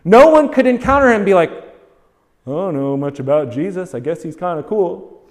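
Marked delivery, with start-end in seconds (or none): 2.5-5.2 a careless, indifferent bystander, lower and softer; mock indifference. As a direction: lower and softer, a bored shrug in the voice. Shrugging off Jesus, mock indifference.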